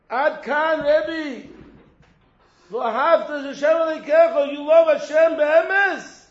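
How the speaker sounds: high, loud, slow preaching at full force; drawn-out impassioned sermon call; fiery preaching. Acted. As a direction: cry it out to the congregation. Preaching climax, voice raised.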